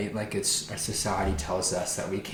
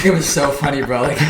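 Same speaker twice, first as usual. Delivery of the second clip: laughing; 6 semitones higher, 12 dB louder than usual. Real, not acted. Laughing at the funny memory.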